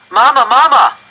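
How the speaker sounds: put-on high, loud child's call for his mother; frightened boy calling his mother. A narrator's reading. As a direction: cry out for mama in alarm. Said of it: "I called to my mother".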